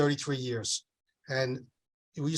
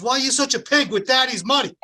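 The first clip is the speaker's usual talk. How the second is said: high, loud mock kid scream; child screaming; 9 semitones higher, 7 dB louder than usual. Little son loudly scolding mommy over daddy's money. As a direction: yell it like an outraged little boy. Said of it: "screaming at me".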